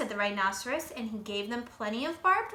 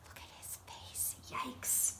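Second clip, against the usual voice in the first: hushed, breathy whisper to the listener; whispered aside; 10 dB quieter than usual. Real, not acted. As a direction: share a scary secret in a whisper. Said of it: "narrator aside whispered".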